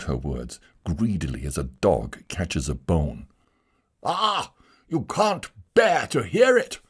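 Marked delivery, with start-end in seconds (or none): 4.0-6.8 high shout of bitter triumph; miserable triumph. Acted. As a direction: crow over her pain. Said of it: "he shouted with miserable triumph".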